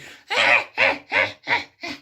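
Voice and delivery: high-pitched burst of laughter. Real, not acted. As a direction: cackle high and loud. Laughing at own joke.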